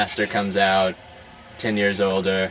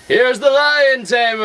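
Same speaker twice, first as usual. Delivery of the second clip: high, loud booming circus announcer; ringmaster announcing; 6 dB louder than usual. Acted. Ringmaster to circus crowd, booming high announcer call. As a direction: sell the act to the whole tent.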